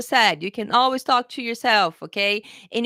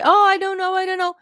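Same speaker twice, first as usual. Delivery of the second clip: mock unsure student; 9 semitones higher, 6 dB louder, faster than usual. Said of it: "And then you say".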